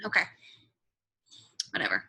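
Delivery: ordinary delivery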